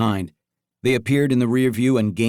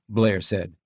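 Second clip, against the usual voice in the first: plain reading voice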